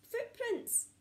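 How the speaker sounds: ordinary delivery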